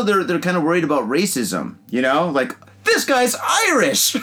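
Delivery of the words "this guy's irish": high, drawn-out mock shout; mock alarm. Imagined moviegoer exposing an accent, high mock-alarmed shout. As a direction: cry it out as a comic accusation.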